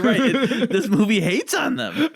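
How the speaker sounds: slow, laughing through the words; amused, laughing delivery. Real, not acted. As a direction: let the laugh come through, slowly. Laughing while talking.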